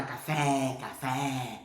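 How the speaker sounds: CAFÉ repeated with a long wide é; open vowel. Acted. Learner repeating for half an hour to baffled Spaniards, open vowel. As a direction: keep insisting with an open CAFÉ. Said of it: "não tem som aberto".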